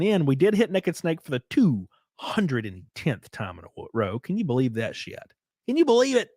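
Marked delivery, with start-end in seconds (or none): 5.7-6.3 excited disbelief. Real, not acted. High excited repeat.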